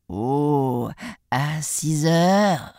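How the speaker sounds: very slow, stretched wolf reply; coaxing wolf. Acted. Wolf sets a time with the piglet, drawn-out and coaxing. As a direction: drawl it out as the scheming wolf.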